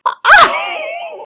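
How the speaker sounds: high, squealing giggle; delighted squeal laugh. Real, not acted. Lights turned on, delighted.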